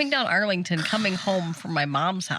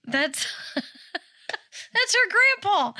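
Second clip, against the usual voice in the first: much higher, slow, laughing through it; laughing explanation; 12 semitones higher, much slower than usual. Retold or imitated. Telling the kids it's her grandpa, laughing. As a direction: giggle out the reveal.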